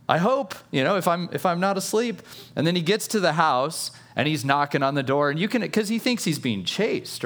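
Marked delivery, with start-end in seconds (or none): 0.1-2.2 breathless relief spilling out, high; giddy relief. Retold or imitated. Escaped Peter telling himself he's free, high giddy relief. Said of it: "'Cause he's like".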